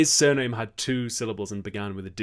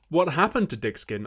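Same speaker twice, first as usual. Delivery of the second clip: normal talking voice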